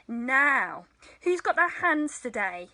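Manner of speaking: normal talking voice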